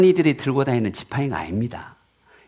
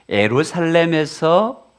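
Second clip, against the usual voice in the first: ordinary talk, nothing marked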